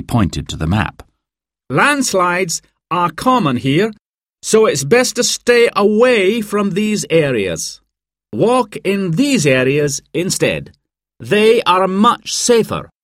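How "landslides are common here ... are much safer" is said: high, projected group-briefing voice; mountain guide presenting. A narrator's reading. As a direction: introduce yourself to guests, pitched well up. Guide Dan briefs the guests.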